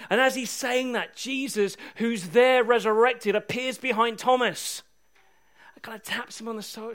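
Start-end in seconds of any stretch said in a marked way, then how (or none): none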